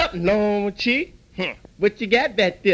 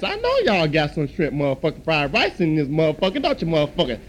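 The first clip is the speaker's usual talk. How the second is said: usual speaking voice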